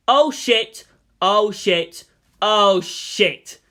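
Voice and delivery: loud, gleeful curse chant; gleeful oh shit. Acted. A distant watcher gloating over the news with a loud, gleeful curse. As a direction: relish the bad news out loud. News reaches the eager enemies.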